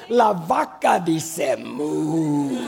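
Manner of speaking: low, drawn-out toy voice in Spanish; slow, low toy announcer with lengthy moo; Spanish talking toy. Retold or imitated. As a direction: imitate the pull-string toy, holding a long moo. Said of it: "And then in Spanish, they have, they go".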